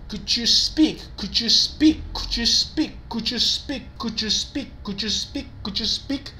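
chant-like teacher's drill of "Could you speak"; drilling the right expression. Shown as a demonstration. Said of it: "the right expression is".

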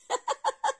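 laugh. Real, not acted. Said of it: "Ma fille rigole".

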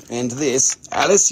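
ordinary delivery

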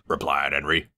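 plain reading voice

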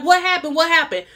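alarmed, high, asking twice; panicked asking. Retold or imitated. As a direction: play her frantic on first sight of the bleeding dog. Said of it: "Alexis run over there like".

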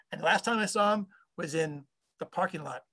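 ordinary talk, nothing marked

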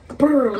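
high, loud rattling "brr"; buzzing vibration noise; imitated machine vibration. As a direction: trill it like a juddering machine. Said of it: "like, it was vibrating".

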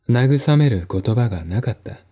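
ordinary delivery, nothing marked

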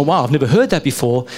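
ordinary talk, nothing marked

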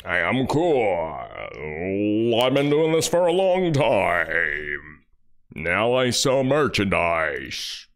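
slow, smug put-on voice; mock radio guy. Mocking the host selling jackets, smug slow voice. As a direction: boast lazily like him.

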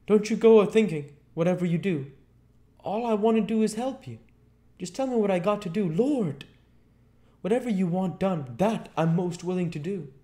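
very high, loud, eager pleading; frightened Marvel. A narrator's reading. Marvel promises the invisible man obedience, frightened and pleading. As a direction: babble assurances in a high panic.

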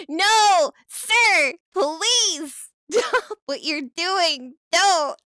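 loud frantic begging; mock desperate plea; hammed-up pleading yell. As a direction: shout at the traitor to stop. Viewer defecting, pleading loudly.

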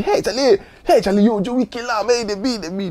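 mock-excited hailing of a friend; excited call. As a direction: imitate a friend calling out, thrilled. Retelling an excited colleague's call.

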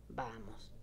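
a soft, kind coaxing word; gentler tone. A narrator's reading. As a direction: soothe him gently. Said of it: "le dijo en tono más dulce".

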